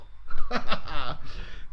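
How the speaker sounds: amused laugh at yourself. Real, not acted. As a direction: chuckle at your own bad impression. Laughs at his own impression.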